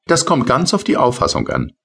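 high, light little sprite voice; tiny rain sprite. Acted. Tipsel's voice in story. (hohe, leichte Koboldstimme)

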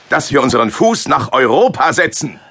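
high, loud proclamation; fervent. Acted. Paulus proclaiming the move to Europe, fervent. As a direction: announce the calling with fire. Announcing mission.

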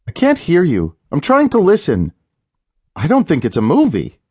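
a wife hushing her husband, uneasy; worried wife; Leah, tense. A narrator's reading. Leah straining to hear TV.

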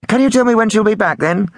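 high, loud, quick caller; prank caller. A narrator's reading. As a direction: press on quickly in a high put-on voice. Prank caller keeps pressing.

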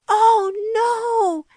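high, loud "Oh, no!"; shocked shout. A narrator's reading. Kittens find the back door open, high alarmed shout. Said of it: "everyone shouted together".

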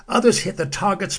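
usual speaking voice

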